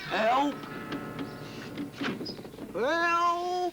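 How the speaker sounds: high, loud panicked cries; high, shrill-loud frightened Noddy; cries for help. Acted. Noddy crying out in danger.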